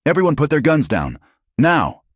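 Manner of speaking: high, loud, insistent order; a deputy ordering everyone to drop their guns; shouted command. Acted. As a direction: command the crowd, hitting now hard. Deputy commanding armed crowd.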